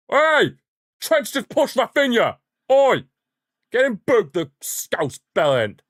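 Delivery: high, loud furious shouting; outraged yell at the TV. Real, not acted. Watching the match, yelling at Trent's push, furious. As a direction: scream for the ref to book him.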